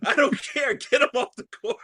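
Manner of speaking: high, laughing rant at the ref; a laughing, hammed-up outraged parent; mock angry parent. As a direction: fume about a dog on the court. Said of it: "I'm like".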